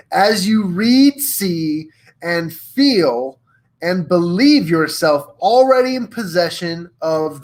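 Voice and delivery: slow, weighty read in bold capitals; all-caps emphasis. Acted. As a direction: punch each key word, drawing it out. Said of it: "in all caps, bold text, Napoleon Hill says".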